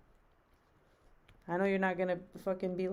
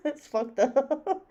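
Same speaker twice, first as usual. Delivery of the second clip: laughing; 7 semitones higher, 8 dB louder, much slower than usual. Real, not acted. Laughing at chat.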